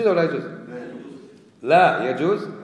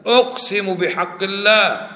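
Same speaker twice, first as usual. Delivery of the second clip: higher, drawn-out solemn swearing; swearing an oath; 5 semitones higher, slower than usual. Acted. Teacher acts out people swearing by God's right, emphatic oath. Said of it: "بعض الناس يا إخوان يقسم".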